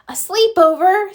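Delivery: thrilled little gasp of a question; excited cry. A narrator's reading. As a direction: burst out with delight. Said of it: "Snail cried".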